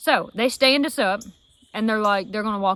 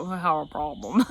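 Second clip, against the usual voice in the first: We have a problem said low and soft like a queasy moan; sickly moan; 6 semitones lower, 5 dB quieter than usual. Shown as a demonstration. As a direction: groan it out, low and weak, sick to the stomach. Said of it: "it sounded more like".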